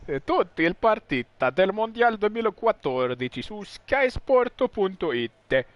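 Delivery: mock Italian TV ad read, slowing at the end; hammed-up Italian promo voice; Italian sportscaster. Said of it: "how would you speak Italian?".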